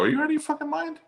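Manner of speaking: high, squeaky outraged voice; mock outrage. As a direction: play himself shocked and shrill. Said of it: "I go".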